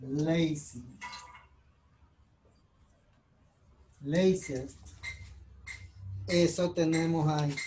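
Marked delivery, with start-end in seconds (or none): 0.0-4.8 lazy and laziest said low and soft as models; model pronunciation. Shown as a demonstration. Teacher modeling lazy and laziest quietly to the class. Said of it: "lo mismo va a suceder".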